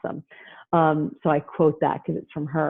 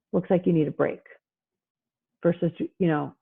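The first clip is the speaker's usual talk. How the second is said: normal talking voice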